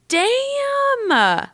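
long, high drawn-out exclamation; retold awe. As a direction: marvel in a high, stretched-out voice. Retold awe at boss's penthouse.

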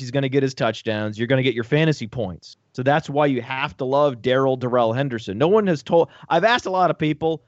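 ordinary delivery

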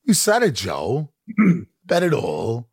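slow, halting quirky actor delivery with odd pauses; Christopher Walken impression. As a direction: draw the line out in a strange stop-start cadence, lightly mimicked. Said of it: "just the way".

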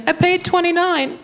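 very high mock mom voice; high-pitched imitation of mom; indignant mom. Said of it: "And she's like".

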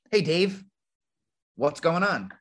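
upbeat, casual hello to a caller; friendly phone greeting. Shown as a demonstration. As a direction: greet Dave like an old friend. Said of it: "here's how I answer the phone".

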